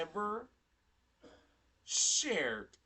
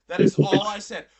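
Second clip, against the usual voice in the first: much louder, stressed denial; forceful protest, voice raised; emphatic insistence; 14 dB louder than usual. Real, not acted. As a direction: loudly insist on every word. Insisting, swearing to God.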